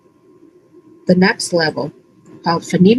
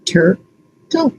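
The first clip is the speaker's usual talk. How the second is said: "Turtle" as tur, pause, tul; chopped into syllables. Shown as a demonstration. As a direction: chop "Turtle" into two separate syllables. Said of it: "Chop turtle".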